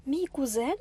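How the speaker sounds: incredulous, rising question; scornful disbelief. A narrator's reading. Girl scorning the rough boy as kin, incredulous. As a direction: query it with mild disdain. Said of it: "вигукнула Кеті, зневажливо розсміявшись".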